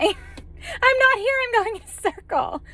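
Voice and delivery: slow, high-pitched laughing whine, half in tears; laugh-crying. Retold or imitated. To the homeowner on the phone, lost again, laugh-crying.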